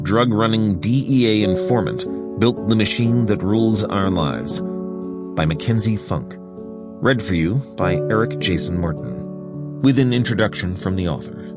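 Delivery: ordinary delivery, nothing marked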